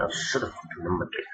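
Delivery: very quiet, under the breath; muttered aside. Real, not acted. Vlogger grumbles to self over unnumbered list, muttered. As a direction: mutter to yourself, barely voiced.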